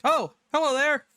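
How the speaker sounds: high, loud cheery greeting; friendly stranger. Acted. Game master voicing the stranger greeting the group, high and cheery. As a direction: sing out a bright hello. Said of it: "He kinda looks over and goes".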